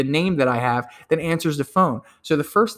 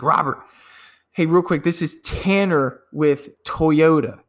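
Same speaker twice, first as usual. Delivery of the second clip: slow, weighty "Tanner with Toyota" with each word leaned on; emphatic intro; slower than usual. Shown as a demonstration. Salesman to Robert, naming Toyota with slow emphasis. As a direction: land the brand name with pride. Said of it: "make sure you say it with emphasis".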